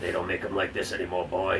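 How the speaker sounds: lightly played father schooling his boy; mock fatherly talk.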